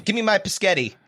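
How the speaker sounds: laughingly mangle spaghetti into "Piscetti"; mock mispronunciation. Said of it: "pronounce English words".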